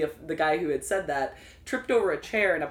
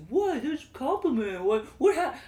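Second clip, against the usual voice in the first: imitating baffled customer, pitched higher; mock baffled; 9 semitones higher, 4 dB louder, slower than usual. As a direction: play the confused creep, caught off guard. Retold customer's baffled reply.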